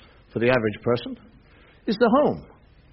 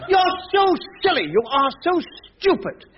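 mother scolding; 7 semitones higher than usual. Retold or imitated. Mother shouting at her son about the Sandman, exasperated. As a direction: shout it at the silly boy.

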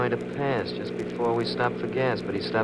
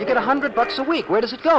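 raised, high-pitched demand; angry nagging; 12 semitones higher, 5 dB louder than usual. Acted. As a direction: press her angrily, voice climbing. Quarrel over money escalates.

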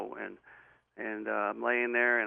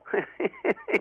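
sudden high burst of laughter; laughing; 3 dB louder than usual. Real, not acted. Laughs at the minister's line.

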